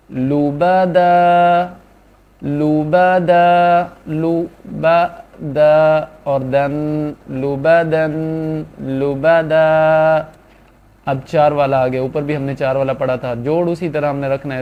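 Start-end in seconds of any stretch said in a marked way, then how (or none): 0.1-10.2 slow drawn-out reading. Shown as a demonstration. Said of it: "وقف اس کا لوبا دا ہو جائے گا".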